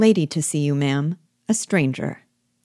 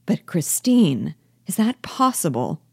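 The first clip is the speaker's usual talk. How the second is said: incredulous. Acted. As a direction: question her as if you can't believe it. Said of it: "looking incredulously at her".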